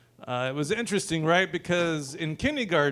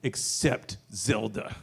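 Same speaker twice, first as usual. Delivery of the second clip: uncle voice; 6 semitones lower, 6 dB quieter than usual. Retold or imitated. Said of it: "He's like".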